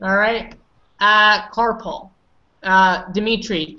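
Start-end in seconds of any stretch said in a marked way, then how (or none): 1.5-2.1 carpool said slowly as KAR-pul, no oo; slow, careful carpal; model pronunciation. Shown as a demonstration. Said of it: "do you mind pronouncing it for me?".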